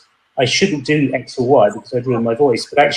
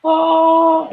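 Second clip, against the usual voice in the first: higher, hooting oh; head voice try; 13 semitones higher, much slower than usual. Shown as a demonstration. Student testing head voice, high hooting oh. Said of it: "what did you do to get that sound".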